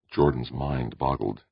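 ordinary delivery, nothing marked

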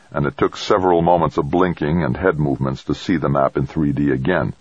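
plain reading voice

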